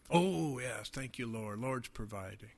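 low, very soft mock-pastor aside; hushed mock piety. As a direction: murmur a mock prayer of thanks. Imagined pastor accepting a pagan's money.

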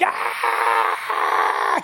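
drawn-out wordless yell; hammed-up crying out, held long; long shriek. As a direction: scream it wordlessly and hold it. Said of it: "it means he shrieked".

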